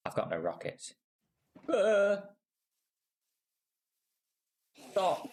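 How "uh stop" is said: high startled shout at the Enderman; sudden frightened cry; panicked yell. Real, not acted. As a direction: yelp and beg it to stop.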